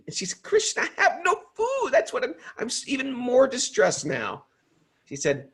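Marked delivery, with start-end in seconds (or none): 0.4-4.4 much higher, pleading woman's voice; a storyteller voicing Draupadi's distress up high. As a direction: plead with Krishna in rising panic.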